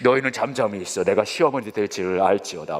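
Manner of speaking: lightly voiced, low mock-biblical decree; a hint of an imposing matriarch, low; solemn mother-in-law. Mother-in-law silencing the in-laws, mock-solemn.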